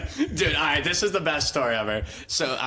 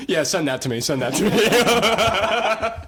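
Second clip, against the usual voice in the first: stretched, laughing plea, higher and louder at the end; laughing mock request; 6 semitones higher, 5 dB louder, slower than usual. Teacher asking for the clip, laughing and drawn out. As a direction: laugh through it, drawing out the last words. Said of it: "be like".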